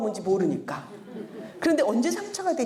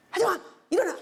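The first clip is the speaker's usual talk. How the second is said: forceful wake-up call; 7 semitones higher, much faster than usual. Shown as a demonstration. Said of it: "힘 빼려고".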